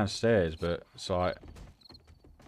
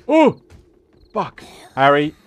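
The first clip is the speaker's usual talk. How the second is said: startled scream; 9 semitones higher, 16 dB louder than usual. Real, not acted. Jump scare by a zombie.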